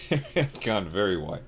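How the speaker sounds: ordinary delivery, nothing marked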